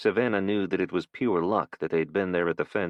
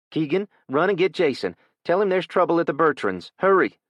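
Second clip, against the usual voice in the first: higher, louder urgent orders rising to a shout on Hurry; urgent call; 10 semitones higher, 6 dB louder than usual. Acted. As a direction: send the boy running for help.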